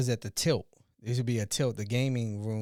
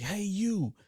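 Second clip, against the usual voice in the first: high, curt summons to a stranger; cop's rude call; 8 semitones higher than usual. Retold or imitated. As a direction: bark it down at the kid. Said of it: "he has this attitude".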